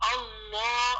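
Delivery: اللہ with a full, heavy lam; heavy Allah. Shown as a demonstration. Teacher showing the heavy lam in اللہ. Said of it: "اللہ کو موٹا کرنا".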